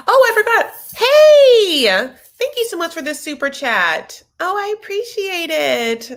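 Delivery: high, delighted squeal of thanks; excited thanks. Real, not acted. Thanking a viewer for their super chat, high and thrilled. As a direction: gush at the surprise gift.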